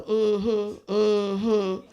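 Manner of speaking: tearful mm-hmm, holding back sobs; nodding along while choking up; a teary, lost mm-hmm; crying through it. Retold or imitated. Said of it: "I cried like a dog".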